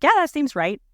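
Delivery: a bright high yes from the midwife; imitating the midwife. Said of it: "she's like".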